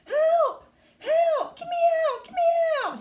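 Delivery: very high, loud panicked yell; high frantic screams; yelling for help. A narrator's reading. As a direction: yell desperately as if drowning. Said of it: "he yelled".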